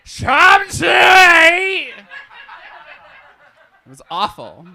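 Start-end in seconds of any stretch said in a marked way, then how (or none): 0.0-1.9 slow, high, loud shouted question; mock shout. Attendant leaning down to a passenger, slow loud shout. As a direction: lean in and yell it slowly. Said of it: "reached down and went".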